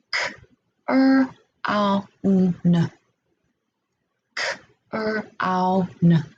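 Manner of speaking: k, r, ow, n each said as a separate sound; sound-by-sound segmenting. Shown as a demonstration. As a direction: break crown into separate sounds. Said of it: "Let's segment our word crown".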